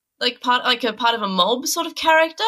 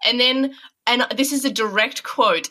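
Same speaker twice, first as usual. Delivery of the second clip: ordinary talk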